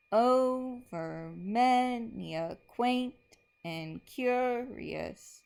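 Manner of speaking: slow, dramatic reading with rising, drawn-out lines; poem recital. Shown as a demonstration. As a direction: read the Raven like a haunting poem. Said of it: "so here we go on this one".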